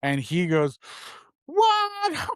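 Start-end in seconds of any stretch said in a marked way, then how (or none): 1.5-2.3 high squeaky disbelieving what; shocked what. Retold or imitated. Retold neighbor yelps in shock at the story. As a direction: yelp it out in total disbelief. Neighbor hears of [name] grandmother.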